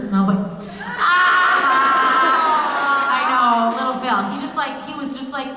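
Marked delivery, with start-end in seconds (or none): 1.0-3.2 mock shout. Mock scream at the director's question.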